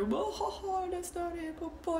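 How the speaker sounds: high, soft mock laughter; making fun. Locals teasing a foreigner trying a new word, mocking. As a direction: imitate people giggling at a foreigner's mistake. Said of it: "they like to make fun".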